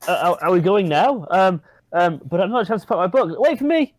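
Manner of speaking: stammer, then cry out after them; flustered panic. Acted. Companion about to be left behind, flustered and calling out.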